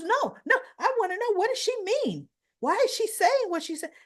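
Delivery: high, loud mock protest; insistent protest. Lucy refuses Daniel's order to leave, insistent and loud. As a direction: act out a daughter refusing to be sent away.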